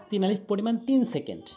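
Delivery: ordinary talk, nothing marked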